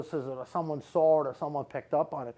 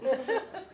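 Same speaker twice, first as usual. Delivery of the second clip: high laugh. Real, not acted. After mocking denial.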